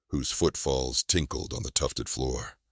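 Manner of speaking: ordinary delivery, nothing marked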